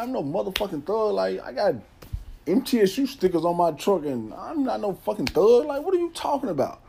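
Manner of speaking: high, outraged denial, rising; insulted, fight back with words. Retold or imitated. Called a thug, protests.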